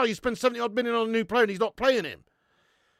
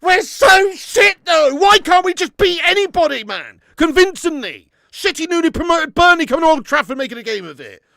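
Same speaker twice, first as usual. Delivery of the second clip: furious ranting shout; angry shout; 7 semitones higher, 12 dB louder than usual. Real, not acted. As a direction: scream your anger at the team. Furious at team's performance.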